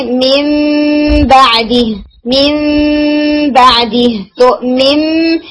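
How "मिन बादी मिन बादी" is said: मिन बादी recited slowly, नून said as मीम with held nasal; Quranic recitation. Shown as a demonstration. Teacher recites second example मिन बादी, in slow recitation. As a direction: draw out मिन बादी as मिम बादी. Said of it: "इसी तरह से".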